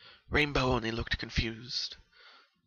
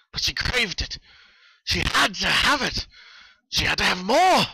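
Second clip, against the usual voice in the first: rising, louder, hungrier with each line; frenzied craving; 6 semitones higher, 6 dB louder than usual. A narrator's reading. Narrator swept up in the killer's hunger. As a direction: let the hunger build to a frenzy.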